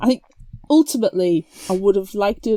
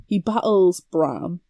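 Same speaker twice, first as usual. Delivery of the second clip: ordinary talk, nothing marked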